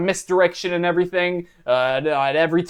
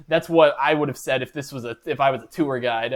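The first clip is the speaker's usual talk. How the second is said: usual speaking voice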